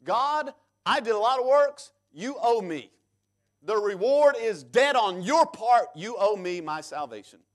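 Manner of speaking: loud, high, indignant mock demand; mock worker shouting his claim at God; demanding God pay up. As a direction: insist God owes you. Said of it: "You're telling God he owes you".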